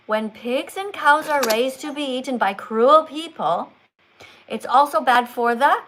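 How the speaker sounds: slow, emphatic reading that hangs before the last word; exaggerated teaching read-aloud. Shown as a demonstration. Teacher restarts the text for student, slow and emphatic. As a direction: read slowly to a child, leaving the ending open. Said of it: "exaggerate, and you put emphasis on some words".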